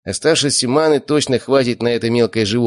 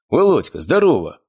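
high, booming hello; hearty greeting; 6 semitones higher than usual. A narrator's reading. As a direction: greet a relative with big delight. Uncle greets nephew heartily.

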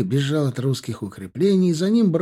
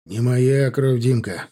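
ordinary delivery

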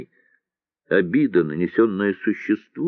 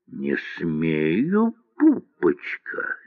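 mock teasing; 3 semitones lower, 3 dB quieter, much slower than usual. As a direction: tease her like a cocky officer. Officer teasing a girl.

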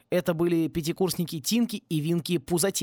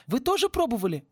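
much higher eager question; perked up; 9 semitones higher than usual. A narrator's reading. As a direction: jump in eagerly. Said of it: "оживился Винки". (оживлённо, высоким голосом)

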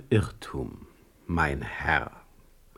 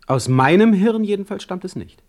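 high, loud and indignant; indignant outburst; 9 semitones higher, 6 dB louder than usual. Acted. Heine to his challenger Stege, denying the rumour, an indignant outburst. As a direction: fling the denial back at him.

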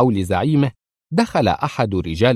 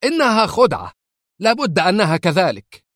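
much higher, frantic yell; nervous shout; 10 semitones higher than usual. Acted. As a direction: shout it in denial. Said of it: "صاح إدوين بعصبية". (صيحة عصبية)

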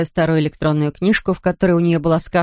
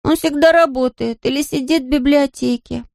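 put-on high-pitched child's complaint; childlike, aggrieved high voice; complaining little boy; 8 semitones higher than usual. A narrator's reading. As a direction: grumble high like a small boy.

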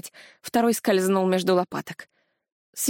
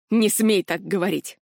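growled. A narrator's reading. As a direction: growl it as a furious dragon. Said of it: "прорычал дракон".

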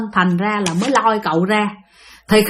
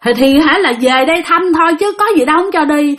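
usual speaking voice

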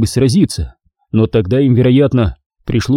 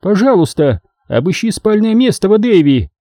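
higher commanding voice; lightly voiced commanding matron; ordering; 9 semitones higher than usual. A narrator's reading. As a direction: give the order firmly. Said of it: "приказала Убин".

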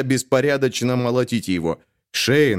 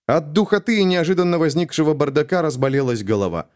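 plain reading voice

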